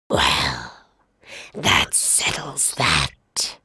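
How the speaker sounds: very low, slow, hushed; lion pleased before his meal; satisfied lion. Acted. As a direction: savor it.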